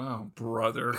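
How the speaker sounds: low, soft, eye-rolling groan; exasperated. Retold or imitated. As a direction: mutter it like you've had enough. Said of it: "time going".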